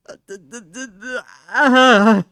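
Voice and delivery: stuttering into a loud cry; stammer, then burst out in anguish; pained stammer. Real, not acted. Streamer groaning at her old cat design.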